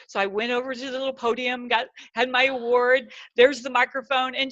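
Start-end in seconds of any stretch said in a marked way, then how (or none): none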